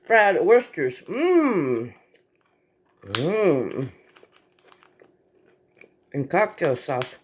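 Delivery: ordinary talk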